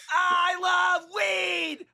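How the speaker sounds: high, slow, screamed declaration; screaming. Pretend. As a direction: belt it like a vaudeville act. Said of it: "Why are you screaming?".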